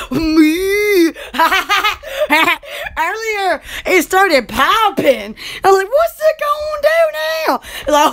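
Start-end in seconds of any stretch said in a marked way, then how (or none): 0.1-1.1 long drawn-out mooing noise; humming appliance-style moo; fridge moo. Retold or imitated. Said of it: "and then it goes". 5.9-7.6 high-pitched retold bewilderment; mock-alarmed high question; startled reaction. Retelling own startled reaction.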